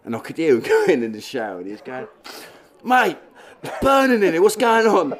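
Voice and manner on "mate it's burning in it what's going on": much higher, louder mock panic; panicked yelp. Dave yelping from the burning shower, high and panicked. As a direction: squeal it like the shower's burning you. Mate in chilli-filled shower.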